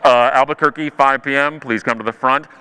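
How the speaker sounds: low, toneless airport intercom call; intercom announcer. Retold or imitated. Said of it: "the intercom comes on".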